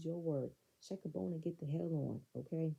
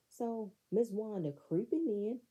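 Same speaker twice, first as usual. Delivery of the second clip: normal talking voice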